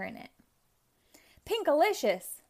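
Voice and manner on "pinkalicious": higher, louder yell of the name. A narrator's reading. Mother catching her daughter at the juice, yelling. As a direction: stop her with a sharp call. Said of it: "Mommy yelled".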